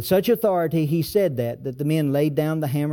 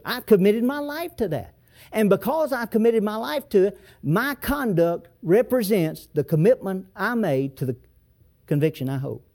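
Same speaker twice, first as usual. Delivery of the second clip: raised, ringing preacher's voice, pitched higher; impassioned sermon delivery; fervent preaching; 5 semitones higher than usual. Acted. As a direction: drive the point home from the pulpit. Preacher building to full force.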